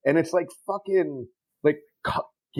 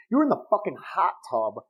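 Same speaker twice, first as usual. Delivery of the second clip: normal talking voice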